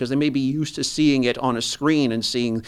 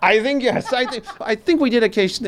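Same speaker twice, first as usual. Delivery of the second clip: laughing; 11 semitones higher than usual. Real, not acted. Laughs while answering.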